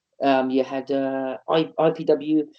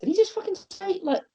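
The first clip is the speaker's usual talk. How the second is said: incredulous inner voice; 3 dB quieter than usual. Retold or imitated. Inner thought of disbelief.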